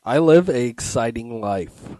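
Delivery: loud, emphatic declaration; mock conviction. Real, not acted. Guest defending his dull life to the host, with mock conviction. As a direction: declare it with booming, put-on conviction. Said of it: "hear the conviction in his voice".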